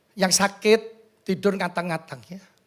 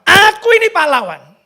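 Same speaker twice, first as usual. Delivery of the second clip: loud, high proclamation; forceful rallying cry; heroic declaration; 9 semitones higher, 10 dB louder than usual. Acted. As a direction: declare it boldly to the whole hall.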